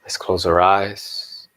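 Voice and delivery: slow, low meditation voice with long pauses; guided meditation. Acted. Teacher leading the morning group into meditation, slow and hushed guided-meditation voice.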